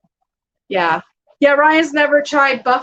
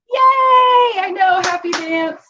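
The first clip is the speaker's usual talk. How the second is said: excited cheer; 9 semitones higher, 4 dB louder, slower than usual. Real, not acted. Happy cheer at donation.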